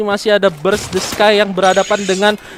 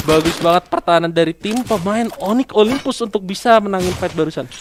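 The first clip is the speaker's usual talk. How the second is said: ordinary talk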